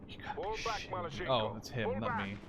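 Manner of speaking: normal talking voice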